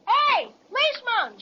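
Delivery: high shout toward the beach; yell. Acted. Boy yells to the policeman on the beach. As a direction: call out across the water. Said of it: "Yell at him".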